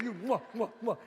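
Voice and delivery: ordinary talk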